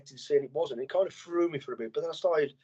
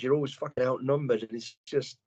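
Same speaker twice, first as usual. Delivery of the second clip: ordinary talk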